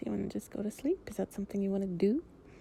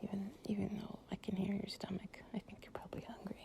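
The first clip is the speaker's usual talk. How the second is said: soft whisper; 18 dB quieter than usual. Acted. Lying close to a partner, drifting off, in a soft whisper.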